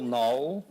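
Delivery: knoll with a long O; rhymes with bowl. Shown as a demonstration. Speaker asks teachers which nonsense reading, knoll rhyming with bowl. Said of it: "is it bowl".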